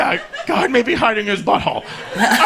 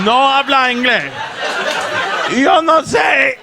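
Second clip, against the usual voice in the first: louder Spanish dodge, building to a yell; mock panicked protest; 4 semitones higher, 4 dB louder than usual. Son desperately dodging dad's question, loud mock protest. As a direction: insist you don't understand, building louder. Feigning no English, escalating.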